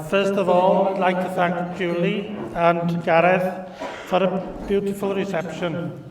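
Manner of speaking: usual speaking voice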